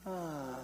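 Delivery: soft, long "ah" of a hug; niece's contented sigh. Retold or imitated. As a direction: imitate a child sighing into a hug. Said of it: "she'd squeeze me real, real tight".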